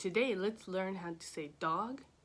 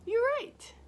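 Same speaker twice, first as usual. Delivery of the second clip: high, happy you're right; excited praise; 14 semitones higher, 5 dB louder than usual. Acted. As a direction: cheer a child's guess. Praising children's answer.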